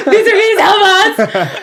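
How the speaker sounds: loud high laughing plea; calling for help. Pretend. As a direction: shout for help while laughing. Lights cut out, calling out.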